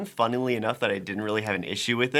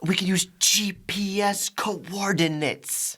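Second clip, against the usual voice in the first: whispering nerd; 9 semitones higher, slower than usual. Retold or imitated. Mocking the nerdy character's line.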